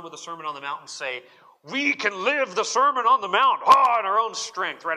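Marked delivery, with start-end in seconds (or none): 1.6-4.8 loud, high, swaggering imitation of self-reliance; mock confident boast. Preacher mocking self-reliant believers, loud boastful imitation. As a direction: puff it up like someone sure they can do it alone.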